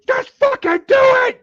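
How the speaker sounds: very high, loud strained scream; imitated screaming. Whole platoon screaming at the recruit stuck on monkey bars, imitated scream. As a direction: scream it in mock exasperation. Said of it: "You know, they're screaming".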